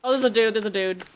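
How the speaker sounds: loud urgent alert; alarmed callout. Real, not acted. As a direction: warn your teammate fast. Spots enemy.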